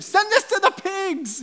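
very high, loud, drawn-out mock plea; desperate begging. Demons begging Jesus to go into pigs, high, loud pleading. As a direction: squeal it slowly as a frantic, over-the-top plea. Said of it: "they begged him".

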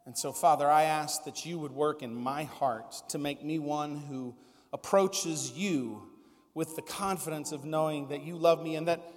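soft, low, sincere pastor's prayer; hushed, heartfelt prayer; closing prayer. Real, not acted. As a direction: close in quiet prayer before the congregation.

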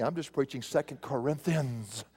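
ordinary talk, nothing marked

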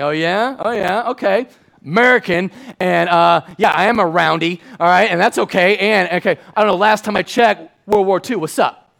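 loud, high cocky bravado; defiant young American. Retold or imitated. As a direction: puff up with mock patriotic swagger.

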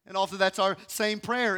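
usual speaking voice

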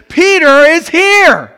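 high, loud and slow cry of joy; excited announcement. Acted. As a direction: call it out like amazing news. Girl announcing Peter at door.

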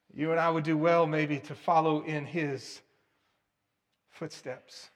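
ordinary delivery

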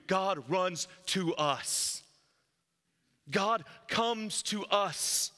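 very slow, word by word; slow emphasis. Real, not acted. Preacher slows to press the gospel on listeners. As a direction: let each word land. Preacher stressing key point.

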